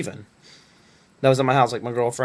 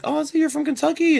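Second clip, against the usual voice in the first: much higher, chirpy mock interviewer voice; mimicking the interviewer. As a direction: lift it way up into a bright fake recruiter voice. Interviewer's line redone in put-on voice.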